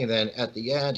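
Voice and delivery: usual speaking voice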